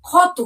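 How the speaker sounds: ক্ষত said loud and clear as "khot" with an aspirated kh; clear pronunciation demo. Shown as a demonstration. Teacher sounding out a word for children, loud and clear. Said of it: "প্রথমে আমরা যে শব্দটি পড়ব".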